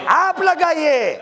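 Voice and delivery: preacher shouting to his listeners to chant; calling out. Real, not acted. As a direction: urge the congregation to raise the slogan. Urging crowd to chant.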